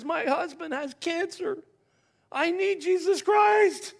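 high-pitched, drawn-out mock wail, building to a loud cry; imitated bawling. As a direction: act out a woman bawling, voice climbing high. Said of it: "She starts bawling".

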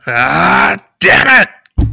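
big angry ogre roar; ogre cursing. Acted. Ogre swearing as its throw misses the party. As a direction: bellow it in frustration like a brute. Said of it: "and it goes".

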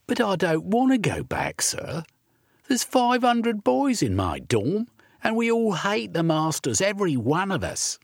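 put-on a boy begging not to be sent back; pleading urchin boy.

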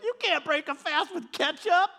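high, mock-laughing teasing; loud, high imitation of helpless laughter; imitating laughing dad. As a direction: crow it like a dad cracking up at his kid. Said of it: "he just starts laughing".

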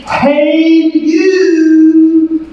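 high, drawn-out hail; calling out. Shown as a demonstration. Preacher showing what behold means, high calling hail. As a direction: sing out to catch someone's attention. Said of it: "look at your neighbor and go".

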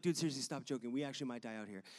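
low-pitched, sober telling-off; retold serious warning, pitched low; dead serious. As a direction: drop the voice and shut the joker down. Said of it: "not funny anymore".

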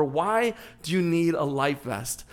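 ordinary talk, nothing marked

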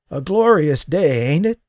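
put-on a frail old man greeting him with effort; croaking old man. A narrator's reading. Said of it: "The voice croaked".

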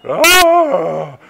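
loud playful fake scare; mock scream. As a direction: yelp as if frightened.

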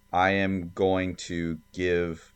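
ordinary delivery

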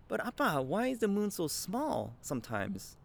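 put-on high, curious little-kid voice; five-year-old son. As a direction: ask Dad like a puzzled small child. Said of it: "He goes".

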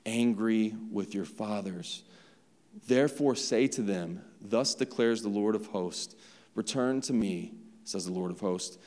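quiet, measured Bible reading; hushed reading of the prophet's words; scripture reading. Acted. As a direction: read the verses softly and steadily. Reads the Zechariah passage.

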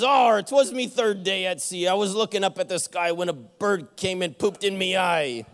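hammed-up pirate captain; captain explains the lost eye, in pirate voice. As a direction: ham up the salty old pirate. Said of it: "that stupid voice".

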